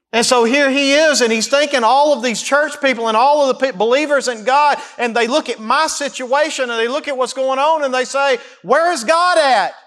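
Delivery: high and loud climactic preaching; impassioned preaching. Acted. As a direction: build the cry to its peak. Preaching at full force.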